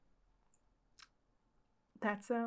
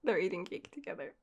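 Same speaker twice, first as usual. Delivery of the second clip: ordinary talk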